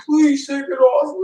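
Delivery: high, slow, whiny begging; mock pleading boss. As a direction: imitate the boss begging him, drawn out. Boss begging to remove review.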